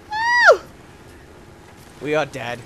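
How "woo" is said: very high loud whoop. Real, not acted. Gamer whooping during a wild dive. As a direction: cheer like a rollercoaster.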